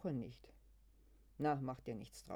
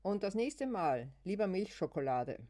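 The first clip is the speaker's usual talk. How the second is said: called out; 5 semitones higher, 7 dB louder than usual. Acted. Ruft im Gehen.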